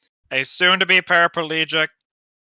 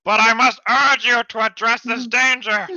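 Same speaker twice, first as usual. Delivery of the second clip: urgent plea; 5 semitones higher, 5 dB louder than usual. Acted. Character in big letters.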